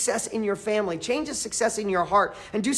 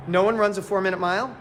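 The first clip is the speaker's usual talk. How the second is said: usual speaking voice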